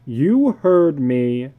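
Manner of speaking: slow, loud and stern; father sending the children to bed. Acted. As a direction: lay down the law, slowly. Father ordering kids to bed.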